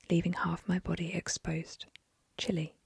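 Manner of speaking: ordinary delivery, nothing marked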